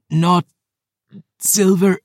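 high, halting pained voice; wounded woman. Acted. Phoebe, poisoned by silver, speaks haltingly in pain. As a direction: labour over each word.

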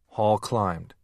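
usual speaking voice

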